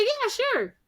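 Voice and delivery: high, eager pushover agreeing; dim-witted victim voice. Retold or imitated. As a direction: squeak out a gullible yes. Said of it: "He's like".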